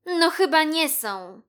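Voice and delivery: high, loud, snide kitten; unpleasant voice. A narrator's reading. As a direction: put the mouse down nastily. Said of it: "powiedziała niemiłym głosem Pusia". (niemiły, wysoki głos kotki)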